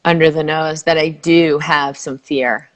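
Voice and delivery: slow, low, calm guiding voice; soothing tapping script; guided tapping. Acted. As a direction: lead the phrase gently for her to repeat.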